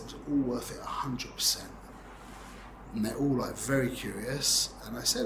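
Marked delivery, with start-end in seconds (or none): 0.0-1.7 hushed, breathy-free whisper of the same line; whispered repeat. Retold or imitated. Repeating his answer to the puzzled table in a whisper. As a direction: drop to a whisper as if sharing a secret.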